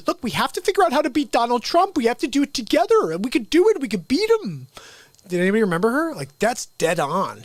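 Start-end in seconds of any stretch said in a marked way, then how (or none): 0.1-4.7 much higher, fast, up-and-down voice; imitating the lady. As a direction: parody her swooping pitch. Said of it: "She was like".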